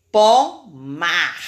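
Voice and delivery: POMAR in two loud syllables, strong R at the end; strong final R. Shown as a demonstration. Teacher showing R ending a syllable, strong R in "pomar". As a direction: punch out "pomar" and let the last R sound strongly. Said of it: "o som também saiu forte".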